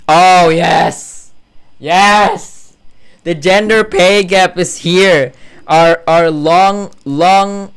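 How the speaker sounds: loud, drawn-out hype; excited announcement. Real, not acted. Hailing a favourite nemesis topic.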